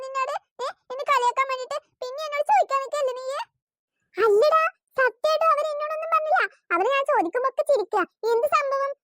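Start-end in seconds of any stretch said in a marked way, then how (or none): none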